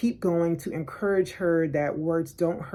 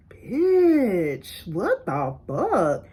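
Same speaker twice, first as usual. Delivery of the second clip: stretched-out, swooping exclamation; outraged disbelief; much slower than usual. Retold or imitated. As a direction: draw it out in slow, incredulous shock. Outraged reaction to being singled out.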